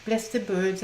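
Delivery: ordinary delivery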